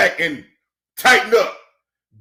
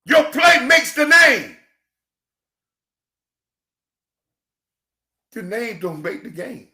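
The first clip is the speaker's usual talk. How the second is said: preaching cadence; 3 semitones higher, 3 dB louder than usual. Acted. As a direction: preach it like a pastor to the congregation. Preaching to viewers, church.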